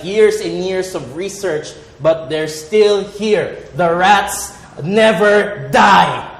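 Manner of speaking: slow and rising frustration at the rats; slow building exasperation. Retold or imitated. Comic telling the audience about rat traps, slow growing exasperation. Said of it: "And I'm like".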